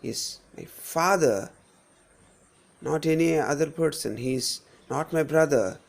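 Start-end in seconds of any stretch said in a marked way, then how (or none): 0.0-1.5 slow, drawn-out "father"; single out "father"; stress on FATHER. Shown as a demonstration. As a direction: pass over "my", lean on "father". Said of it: "If we emphasize this particular word".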